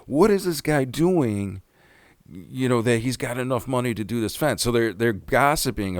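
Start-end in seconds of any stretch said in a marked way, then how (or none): 0.1-4.6 high, nosy gossip voice, slowing on the question; suspicious gossip. Retold or imitated. Retelling the neighbor gossiping about the fence money, high and nosy. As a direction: imitate the nosy neighbor wondering aloud, pitched up. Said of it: "He goes".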